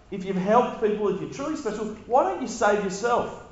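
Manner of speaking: high, sneering taunt; mock him from below the cross; mocking ruler. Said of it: "They're saying".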